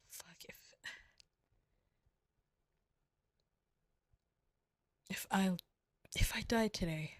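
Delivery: hushed, tender whispered confession; emotional whisper. Acted. Whispering love confession to partner, hushed and emotional. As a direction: breathe it out like a secret you can barely say.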